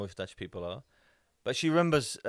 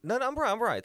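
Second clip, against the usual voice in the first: insistent; 12 semitones higher, 6 dB louder, faster than usual. Real, not acted. Insisting he is right.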